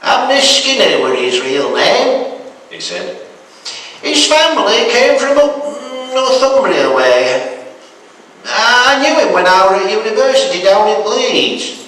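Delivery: a hint of an old northern grandad spinning a yarn; old Yorkshire granddad. Granddad tells his grandson about Abner, lightly voiced.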